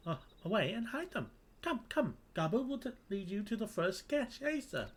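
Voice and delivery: high-pitched eager kobold; squeaky-high creature voice, keen to help; Gabu. Acted. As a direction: play a servile little kobold, pitched high.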